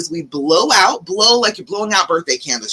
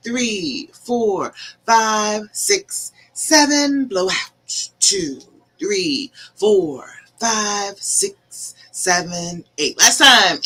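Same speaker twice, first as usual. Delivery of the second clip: guided breathing; much slower than usual. Acted. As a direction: calmly count them through each breath. Leads a counted breathing exercise.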